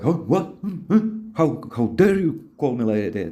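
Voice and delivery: high, mock-offended huh and what; mock outrage. Shown as a demonstration. Coach demos mock outrage at her insult. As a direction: act fake offended at being called an idiot. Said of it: "and you are like".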